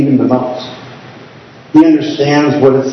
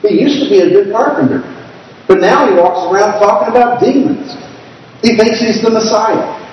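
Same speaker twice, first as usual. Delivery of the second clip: higher, scoffing gossip about a crazy relative; mocking gossip; 7 semitones higher than usual. Family members mocking Jesus, higher and scoffing. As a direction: sneer it like a nosy family member. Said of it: "Or as we would say today".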